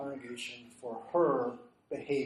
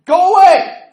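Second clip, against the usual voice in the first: high, loud order building to a shout; retold exasperated yell; acted forceful dismissal; 13 semitones higher, 17 dB louder than usual. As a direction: send him off, louder each time. Retold ordering a man away.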